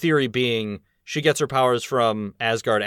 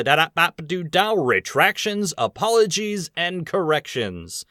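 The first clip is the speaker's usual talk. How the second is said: higher-pitched fanfare, then a grand title read; mock segment announcer; 6 semitones higher, slower than usual. Podcast host introducing the corrections segment, mock announcer fanfare. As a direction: playfully herald the segment like a jingle announcer.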